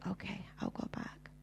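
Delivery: soft breathy whisper, barely voiced; whispered reply. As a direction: whisper it like a frightened child giving in. Girl answers the kind voice.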